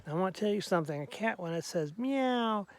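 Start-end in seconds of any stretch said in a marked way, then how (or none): 2.0-2.7 a drawn-out little meow; slow demonstration meow; unhappy cat meow. Shown as a demonstration. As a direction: meow like a cat that isn't happy. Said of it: "it means it's not happy".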